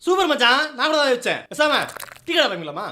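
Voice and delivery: high eager sidekick voice. Acted. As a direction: pipe up with a silly idea.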